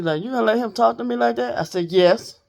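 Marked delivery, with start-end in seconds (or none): 0.2-1.6 high, fast, indignant squawk of the foster mama; outraged foster mama. Retold or imitated. Foster mama demanding I back her, high and fast indignant. 1.9-2.4 a loud, punched yes; defiant yes. Retold or imitated. Answering the foster mama's demand with a loud defiant yes.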